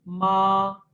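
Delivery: माँ held long and level, strongly voiced; first tone, flat. Shown as a demonstration. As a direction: sustain माँ on one even pitch. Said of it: "first tone जो है वो".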